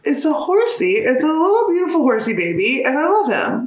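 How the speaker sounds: high, doting gush over a horsey; cutesy baby talk. Retold or imitated. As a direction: dote on it like a baby. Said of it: "And I'm like".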